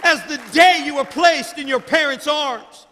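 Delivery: loud, high, shouting sermon climax; building fiery sermon, shouting God's plea; preaching at full force. Acted. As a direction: preach it to the rafters.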